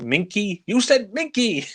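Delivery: high-pitched mock disbelief; incredulous. Exasperated boss echoing Clouseau's odd word, high incredulous mock squeal. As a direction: squeal it back as an exasperated imitation.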